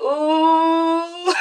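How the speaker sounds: mock awe at Boston's offer; mocking ooh. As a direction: pretend to be impressed. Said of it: "and I'm like".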